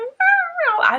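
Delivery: very high, loud whimpering meow; puppy cry. Retold or imitated. Hurt puppy crying after the fall, high squeal. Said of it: "He was like".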